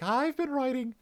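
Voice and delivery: bad Liverpool accent, voice up high; attempted Liverpool accent. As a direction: try a Liverpool accent and miss it. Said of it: "I can't do a Liverpool accent".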